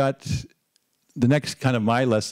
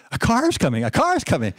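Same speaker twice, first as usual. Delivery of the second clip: put-on higher, excited children calling out; excited kids; 9 semitones higher than usual. Kids on the hill spotting the state car, excited higher calling. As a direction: call it out like thrilled kids spotting something. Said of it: "jumping up and yelling all excited".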